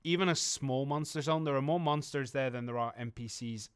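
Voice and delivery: ordinary delivery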